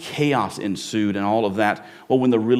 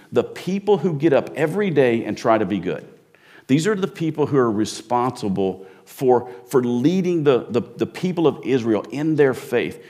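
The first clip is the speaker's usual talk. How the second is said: normal talking voice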